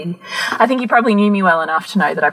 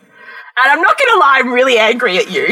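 much higher, louder, half-laughing telling off; retold rant; 7 semitones higher, 4 dB louder than usual. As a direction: scold him, amused at yourself. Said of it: "this five-minute rant".

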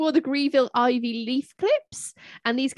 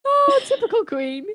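amused; 11 semitones higher, 3 dB louder than usual. Real, not acted. Amused comment on the Queen.

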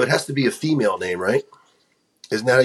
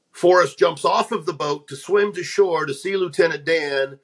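normal talking voice

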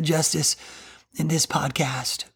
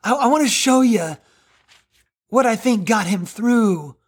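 normal talking voice

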